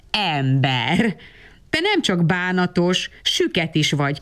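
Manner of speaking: plain reading voice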